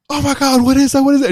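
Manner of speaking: high, loud panicked yelps; James Franco panicking. Retold or imitated. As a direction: freak out at something you can't see. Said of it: "James Franco is like".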